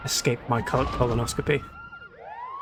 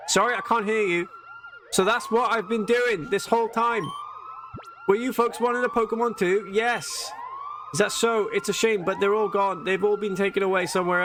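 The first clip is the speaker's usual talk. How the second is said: higher, chatty villager voice, just a hint of a character; lightly voiced game villager; 6 semitones higher than usual. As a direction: read it as a friendly villager, pitched up.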